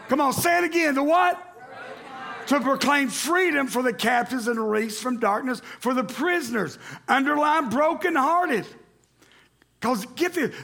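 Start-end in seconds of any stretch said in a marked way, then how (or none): 0.1-1.5 high, loud call to answer; shouting encouragement. Real, not acted. Pastor shouting at church to say it again. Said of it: "urging congregation louder". 2.5-6.8 raised, ringing scripture read. Acted. Pastor reading Isaiah aloud to church, ringing.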